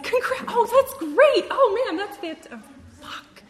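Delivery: very high, loud squeal of delight; squealing excitement. Retold or imitated. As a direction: gush like a thrilled sponsor tripping over her words. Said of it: "And she, like, squealed".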